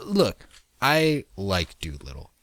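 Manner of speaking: ordinary delivery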